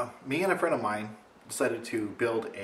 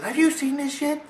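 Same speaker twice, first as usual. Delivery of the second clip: very high loud accusing voice; shrill-free mock accusation, pitched way up and loud; accusing friend; 5 dB louder than usual. Friend phoning to accuse him of being the gnome, high loud. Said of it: "she calls me up ... says".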